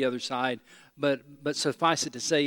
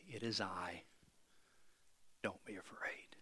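soft whisper, lower, each word gentle; whispered reassurance; 7 semitones lower, 20 dB quieter than usual. Acted. Jesus to the scared disciples, whispered reassurance. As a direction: whisper it like comforting a frightened friend.

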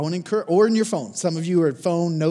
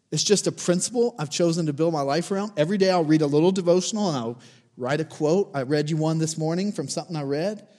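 ordinary talk

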